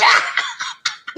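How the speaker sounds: hearty burst of laughter. Real, not acted. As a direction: laugh along with your friend. Laughs after greeting fellow Carolinian.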